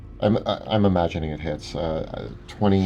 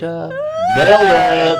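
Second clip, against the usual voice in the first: mock triumphant; 9 semitones higher, 6 dB louder than usual. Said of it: "Wrath's just like".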